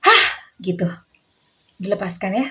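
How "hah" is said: short sharp shouted hah; shouted release. Shown as a demonstration. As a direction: throw the feeling out with one yell. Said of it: "sambil teriak".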